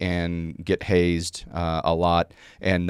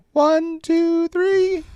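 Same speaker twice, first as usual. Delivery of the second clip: put-on very high little-girl count; 4 dB louder, much slower than usual. Daughter counting push-ups for the Ranger students, high-pitched. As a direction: call the cadence like a bossy child. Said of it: "little girl high-pitched voice".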